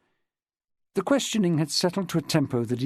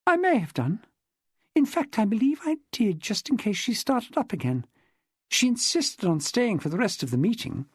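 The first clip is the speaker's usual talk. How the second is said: higher-pitched woman's dialogue voice; lightly voiced chatty woman witness; 8 semitones higher than usual. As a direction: lightly play a woman recounting her club life. Narrator voices Jessica in dialogue.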